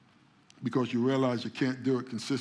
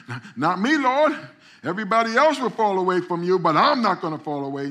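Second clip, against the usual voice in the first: higher, louder eager insistence; Peter's protest; 6 semitones higher, 9 dB louder than usual. Retold or imitated. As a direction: insist hotly you'll never fall. Peter protests his loyalty.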